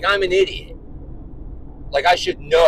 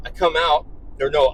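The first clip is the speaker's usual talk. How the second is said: ordinary talk, nothing marked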